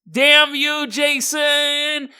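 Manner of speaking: high, hammed-up cry of damn you; mock furious curse. Dying crocodile curses Jason, high hammed-up yell. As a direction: shake a fist at the sky, hammed up.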